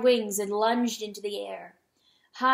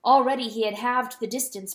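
ordinary delivery, nothing marked

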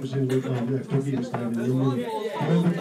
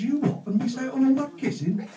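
much higher, incredulous squeak; mock astonished; 11 semitones higher than usual. Welsh farmer answering the surveyor, mock astonished high voice. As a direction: play the farmer's shocked disbelief.

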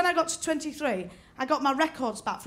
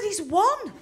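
incredulous; 6 semitones higher, 4 dB louder than usual. Retold or imitated. Incredulous retort to friend.

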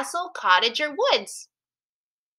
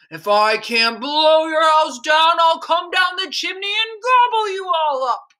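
wolf's shout; 6 semitones higher, 4 dB louder than usual. A narrator's reading. Furious wolf threatens the pigs down the chimney, shouting. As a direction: shout it down in full storybook villain voice. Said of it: "shouted down the chimney".

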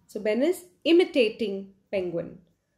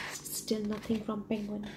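ordinary delivery

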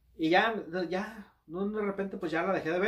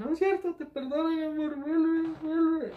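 high-pitched mock begging; lovesick pleading; 12 semitones higher, 4 dB louder than usual. Acts out his secret inner plea.

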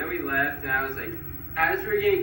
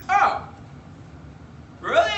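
much higher, mock feminine customer-service voice; woman-like guy; 4 dB louder than usual. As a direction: squeak it up like the agent he took for a woman. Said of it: "this guy who I thought was a woman".

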